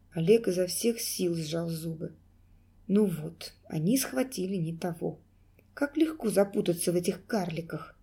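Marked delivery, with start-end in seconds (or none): none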